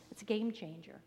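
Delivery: ordinary delivery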